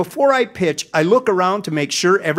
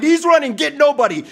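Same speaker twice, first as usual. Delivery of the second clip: mock shout; 7 semitones higher, faster than usual. Giant fielder warning his team about the runner, mock shout. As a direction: bark it across the field like a panicked giant. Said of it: "shouted the giant".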